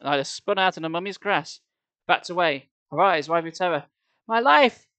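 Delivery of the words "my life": much higher, loud despairing shriek. A narrator's reading. Nyla shrieking over the broken pendant, despairing shriek. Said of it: "she shrieked".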